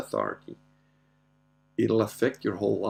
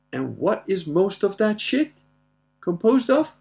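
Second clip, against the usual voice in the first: high, leading question, building louder; mock teacher prompting a pupil, slow at first; quizzing dad; 10 semitones higher, 5 dB louder than usual. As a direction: draw the answer out, rising at the end. Dad quizzing daughter, retold.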